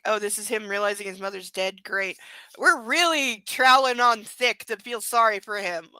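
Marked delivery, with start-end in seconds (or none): none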